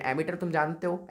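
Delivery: ordinary delivery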